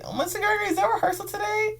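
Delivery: put-on high girlish student voice. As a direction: squeak it out like an eager young student. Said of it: "one of my Winter Guard students was like".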